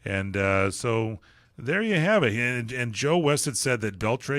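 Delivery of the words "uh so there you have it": normal talking voice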